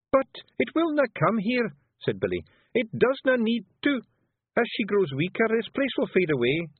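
broad Scots brogue, lively and earnest; a wee blue Feagle warning his chief; Scottish pictsie voice. Acted. Billy objects.

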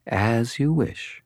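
slow, high, pleased and polite; politely satisfied. A narrator's reading. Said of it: "polite satisfaction in her tone".

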